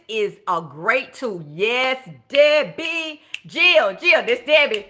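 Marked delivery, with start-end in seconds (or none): 1.5-4.8 higher and louder, calling out names; thrilled shout-out to a viewer; excited cheering. Real, not acted. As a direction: get hyped for her.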